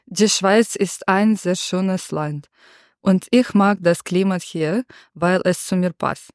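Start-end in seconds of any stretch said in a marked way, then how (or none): none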